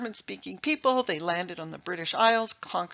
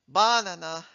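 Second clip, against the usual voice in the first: banana with the first syllable made the loudest; wrong stress; 2 semitones lower, 5 dB louder than usual. Shown as a demonstration. Showing the audience another wrong stress: BAnana. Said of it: "are not words".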